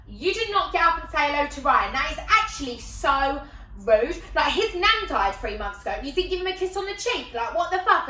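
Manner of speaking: loud, high telling-off; indignant scolding. Retold or imitated. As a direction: lay into a friend, scandalised. Friend tells her off.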